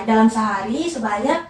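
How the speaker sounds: ordinary delivery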